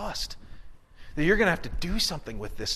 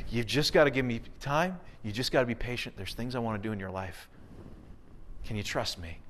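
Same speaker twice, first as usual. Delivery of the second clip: gentle voice fading softer and lower; tender reassurance; 4 semitones lower, 6 dB quieter than usual. Pretend. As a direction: speak as a patient, reassuring God, growing hushed. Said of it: "he's always saying".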